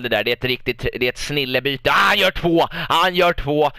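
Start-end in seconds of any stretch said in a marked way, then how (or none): 1.9-3.7 loud, rising cheer over a goal; excited goal call. Real, not acted. Commentator cheering his striker's second goal, excited shout.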